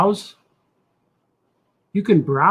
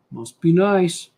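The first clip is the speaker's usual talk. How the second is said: envious quip; slower than usual. Retold or imitated. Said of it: "The must be nice guy".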